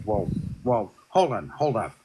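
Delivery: low, flustered imitation of Bernadette; flustered mock voice.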